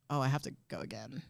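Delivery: normal talking voice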